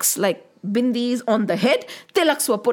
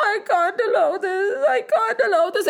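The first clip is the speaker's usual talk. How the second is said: high, sobbing protest; hammed-up crying; 7 semitones higher, faster than usual. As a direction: wail it like a distraught mother. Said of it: "the mother started crying".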